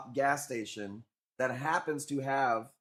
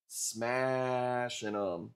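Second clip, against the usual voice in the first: drawn-out echo; much slower than usual. Real, not acted. Echoing himself.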